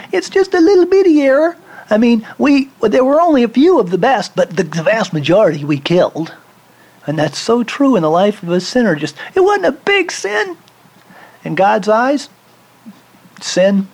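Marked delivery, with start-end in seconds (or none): 0.1-6.3 high, squeaky belittling whine; mocking excuse. Preacher mocks Saul belittling his error, high squeaky whine. Mocking Saul's minimized sin. 8.9-10.6 much higher, whiny dismissive voice; mocking sinner. Preacher mimics a sinner excusing himself, high mock whine.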